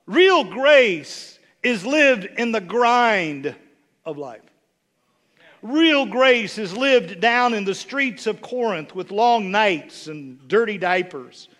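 slow, weighty sermon delivery; slow emphatic preaching. Real, not acted. Pastor drives home the main point to the congregation. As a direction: lean on every word slowly.

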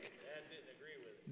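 breathy sotto voce aside, nearly silent; whispered aside. Real, not acted. Pastor whispers a joke aside to the congregation. Dry aside about Dad.

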